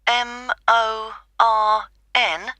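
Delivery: M O R N spelled slowly, each letter separate. Real, not acted. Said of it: "Was it Morn?".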